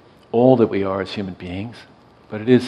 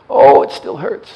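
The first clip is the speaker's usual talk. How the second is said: high, slow groaning complaint; a hurting patient drawing it out; voicing a dying man in pain; pained old man; 13 semitones higher, 8 dB louder, slower than usual. Patient in pain, voiced by teller.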